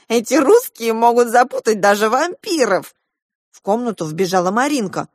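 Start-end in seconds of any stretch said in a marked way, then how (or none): 0.1-2.9 loud, high outburst; exasperated cry. A narrator's reading. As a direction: exclaim in loud, baffled exasperation. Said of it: "вскричал Матиас".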